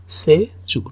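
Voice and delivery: ordinary talk, nothing marked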